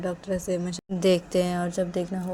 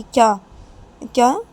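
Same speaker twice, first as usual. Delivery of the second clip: loud, raised demand; sharp questioning; 5 semitones higher, 10 dB louder than usual. Acted. Mother questions Nisha, sharp and loud. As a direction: demand to know, startled.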